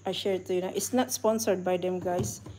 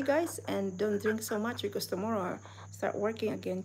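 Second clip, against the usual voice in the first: ordinary talk, nothing marked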